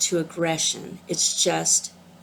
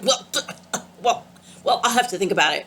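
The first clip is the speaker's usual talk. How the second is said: mock sputtering; faster than usual. The vendor sputtering after the gentle reply. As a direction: act flustered and lost for words. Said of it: "she just started sputtering".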